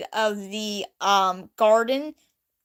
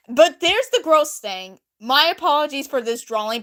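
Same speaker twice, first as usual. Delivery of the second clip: ordinary talk, nothing marked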